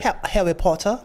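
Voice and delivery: imitated British Harry Potter accent; British accent imitation. Proving he can do that, mock British accent. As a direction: copy the British accent just heard. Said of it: "I can do that".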